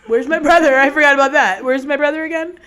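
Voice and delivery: hammed-up loud demand; yelling demand. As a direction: bark it out like an angry big brother. Chet yells for his brother.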